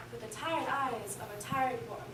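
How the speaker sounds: usual speaking voice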